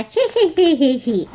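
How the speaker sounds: high "hee hee hee" giggle; gleeful wolf snicker. Acted. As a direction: titter like greedy storybook wolves. Greedy wolves chuckle together.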